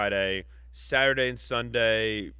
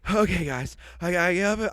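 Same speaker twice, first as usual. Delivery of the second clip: Mark Wahlberg impression; 6 semitones higher, 3 dB louder than usual. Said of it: "say it like Mark Wahlberg would say it".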